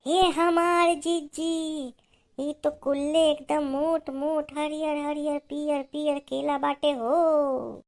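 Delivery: high, drawn-out marvelling; awed delight. Acted. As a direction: gush slowly in a high voice over a lovely find. Marvels at bananas seen overhead.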